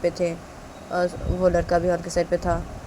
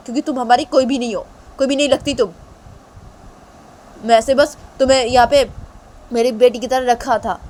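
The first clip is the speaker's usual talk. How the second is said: loud stern father, fading to cold; angry father; 6 semitones higher, 7 dB louder than usual. Acted. Father telling strangers to take daughter, loud and cold. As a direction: bark her away, then tell them to take her.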